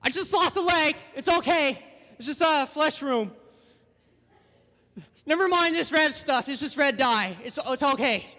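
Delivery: high, loud mock bravado; hammed-up wounded swimmer insisting all is well; strained denial. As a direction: play down a terrible injury, comically strained. Acts maimed swimmer downplaying injury.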